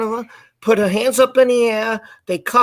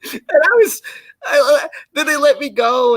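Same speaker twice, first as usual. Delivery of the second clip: laughing; 5 semitones higher than usual. Real, not acted. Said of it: "laughing through the tale".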